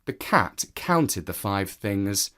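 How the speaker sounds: usual speaking voice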